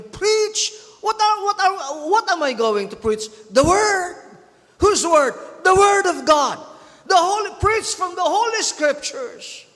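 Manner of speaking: high, loud call-and-answer preaching that builds to a shout; fiery preaching. Acted. Preacher quizzing the congregation, loud call and answer. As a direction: fire questions and hammer the answers.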